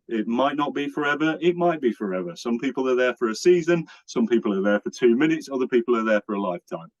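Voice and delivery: ordinary talk, nothing marked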